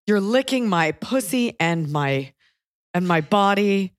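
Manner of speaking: slow, deliberate recital of raunchy lyrics; slow imitation of mom reading. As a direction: read the lyrics out slowly, word by word. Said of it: "read the lyrics".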